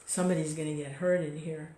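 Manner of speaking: ordinary delivery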